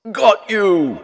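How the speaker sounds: very high, loud grab; monster shout. A narrator's reading. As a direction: shout it as he seizes. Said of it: "shouted the monster".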